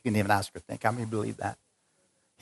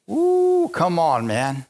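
ordinary delivery

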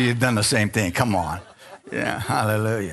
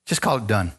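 ordinary talk

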